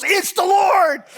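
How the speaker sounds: high, loud, drawn-out cry of recognition; shouting it's the Lord from the boat; excited shout. Retold or imitated. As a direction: call out in sudden joyful recognition. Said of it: "John goes".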